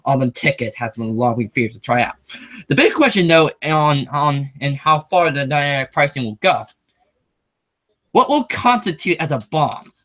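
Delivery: steady reading of a news piece; reading the article to viewers; article read-aloud. Acted.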